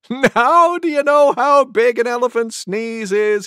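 high, loud, teasing question; gleeful. A narrator's reading. Cuckoo teasing Monkey after the sneeze, high and gleeful. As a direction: crow it in amusement. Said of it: "Cuckoo began to laugh".

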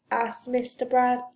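usual speaking voice

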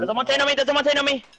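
high alarmed call for help; panicked callout. Real, not acted. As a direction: shout it out high and urgent. Enemy pushing him in game.

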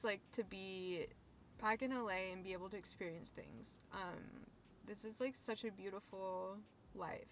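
soft, low and tearful; subdued, choked-up gratitude; holding back tears. Real, not acted. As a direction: trail off quietly, close to crying. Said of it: "I'm so sorry for crying".

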